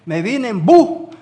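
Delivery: "bu" said without its final S, drawn out and loud; dropped final S. Shown as a demonstration. Preacher shows congregation how a regional accent sounds, dropped S on "bu". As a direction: stretch "bu" and leave the S off the end. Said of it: "no pronunciaban bien una letra parecida".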